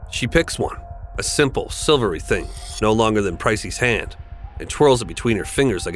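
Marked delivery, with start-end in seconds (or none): none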